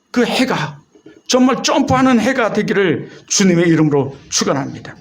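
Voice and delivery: normal talking voice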